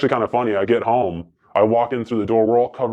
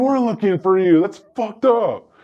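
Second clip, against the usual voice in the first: dad's line pitched much higher and slowed; 12 semitones higher than usual. Retold or imitated. Dad voiced in story.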